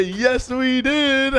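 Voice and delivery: high, drawn-out celebration; triumphant cheer. Acted. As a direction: stretch it out as a joyful cheer. Celebrating success with director.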